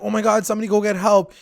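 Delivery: fast, high mock panic; high-pitched hurried plea, not shouted; freaking out. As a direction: retell a panicked cry for help, rushed. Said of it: "freaks out".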